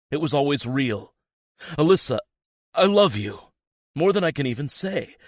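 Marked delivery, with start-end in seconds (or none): 1.8-5.2 high, loud impassioned declaration; pleading love. A narrator's reading. Boyfriend declaring love to girlfriend, loud impassioned. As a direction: throw everything into it.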